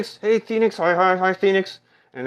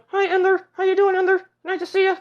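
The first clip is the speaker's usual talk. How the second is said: high sing-song greeting. Pretend.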